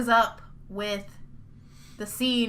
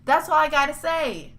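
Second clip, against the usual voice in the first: higher-pitched, furious ranting; mad tirade; 3 semitones higher than usual. Real, not acted. Fan berating Telltale for poor business, mad tirade. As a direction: let the outrage pour out in a tirade. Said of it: "I'm so goddamn mad".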